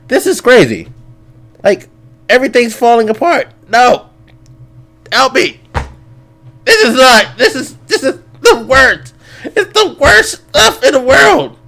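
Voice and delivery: high, wailing mock panic; mock despair. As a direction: whine that everything is falling apart. Everything falling apart, mock despair.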